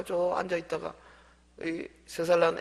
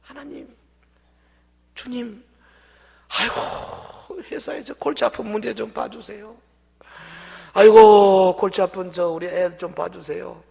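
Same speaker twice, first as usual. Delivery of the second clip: high, fretful complaining plea; whining prayer; 7 semitones higher, 10 dB louder than usual. Retold or imitated. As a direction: moan your troubles to God. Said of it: "그 문제만 보기 쉽습니다". (투덜대며 하소연하는 기도)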